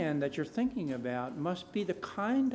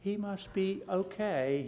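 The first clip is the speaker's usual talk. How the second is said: ordinary talk, nothing marked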